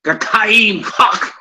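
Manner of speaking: "Kakaim cock" high and drawn out, choking on the k sounds; mock choking pronunciation. Teacher mocking others' Hebrew pronunciation, "Kakaim cock" high and choked. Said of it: "sound like you're choking on your food".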